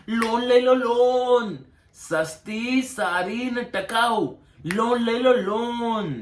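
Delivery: street hawker's cry. Retold or imitated. Mocking bankers as pushcart vendors selling loans, hawker's singsong call. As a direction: hawk it from a pushcart, stretching the ends. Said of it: "એના કરતા લારી લઈને નીકળતા હોય તો".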